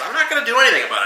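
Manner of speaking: fast, high-pitched defensive retort; quick high retort. Retold or imitated. Bartender to angry customer, fast high retort.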